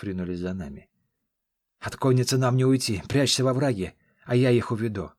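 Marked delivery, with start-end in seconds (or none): 1.8-5.1 louder urgent command; urgent shout. Acted. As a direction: shout the plan on the run. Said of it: "крикнул я Скале".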